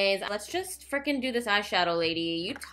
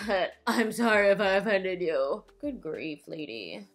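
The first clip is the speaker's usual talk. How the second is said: ordinary delivery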